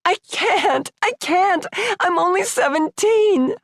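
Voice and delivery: high tearful wail. Acted. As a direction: wail it out in tears. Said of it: "I wailed".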